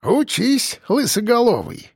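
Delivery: put-on high-pitched old man's jibe; wry, winking old man voice; teasing grandad. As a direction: needle him playfully as an old-timer. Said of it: "подмигнул он ворону".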